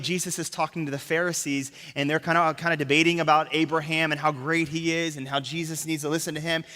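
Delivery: usual speaking voice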